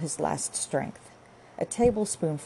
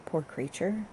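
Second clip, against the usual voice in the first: ordinary delivery, nothing marked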